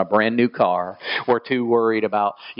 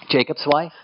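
ordinary delivery

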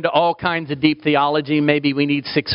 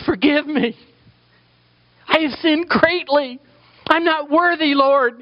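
high, earnest plea of a repentant sinner; penitent prayer; 4 dB louder than usual. Acted. Sinner turning to God in prayer, high earnest pleading. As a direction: cry out your unworthiness to the Lord.

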